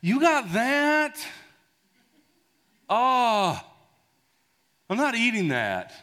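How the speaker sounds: put-on high, loud, dragged-out kid protest; complaining kid. Kid to parents about dinner, loud high protest. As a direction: imitate a child appalled by dinner. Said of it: "one of our kids was like".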